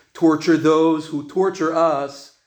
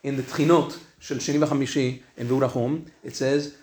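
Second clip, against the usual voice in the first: ordinary talk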